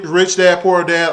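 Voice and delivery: usual speaking voice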